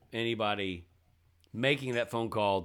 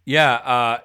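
telemarketer voice; 8 dB louder, slower than usual. Retold or imitated. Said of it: "make them go like".

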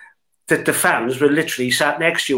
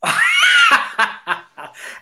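very high burst of laughter; helpless high-pitched cackle; shrieking laugh. Real, not acted. Guest reacting to the sock story, in a shrieking laugh.

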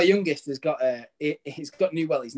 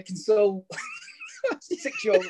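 higher voice breaking into laughter; laughing while talking. Real, not acted. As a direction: tell it through laughter. Laughing while telling son's agony story.

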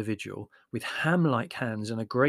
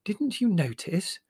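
slow, high-pitched astonishment; astonished; 8 semitones higher, slower than usual. A narrator's reading. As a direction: ask it slowly, in high disbelief. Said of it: "Mother regarded him with astonishment".

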